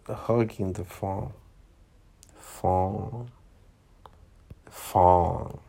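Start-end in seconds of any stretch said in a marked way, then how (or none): none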